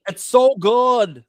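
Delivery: slow, drawn-out New England accent. Shown as a demonstration. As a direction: say it like a Boston local. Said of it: "That's my New England accent".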